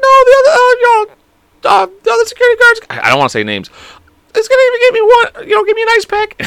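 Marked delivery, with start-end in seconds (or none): 0.0-2.8 loud falsetto whimper, struggling for words; hammed-up high, halting wail; high-pitched pained voice. Same high pained voice, acted. 4.3-6.4 very high falsetto squeal; hammed-up pained squeak; high-pitched pained voice. Same high pained voice, acted.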